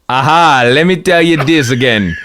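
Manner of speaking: loud, hammed-up Jamaican accent. AI retelling its answer on Taiwan, hammed-up Jamaican accent. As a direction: belt it out in a mock Jamaican lilt. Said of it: "but in a Jamaican accent".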